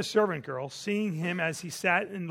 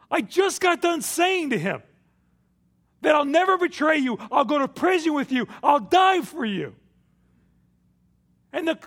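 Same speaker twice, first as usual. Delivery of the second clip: loud, high, anguished self-reproach; anguished self-blame; 8 semitones higher, 10 dB louder than usual. Retold or imitated. Peter berating himself for betraying his Lord, in a loud anguished voice. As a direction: cry out your own failure. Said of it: "I'm sure Peter was thinking".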